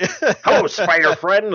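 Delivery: put-on high cheery old man; chirpy elderly greeting; Stan Lee voice.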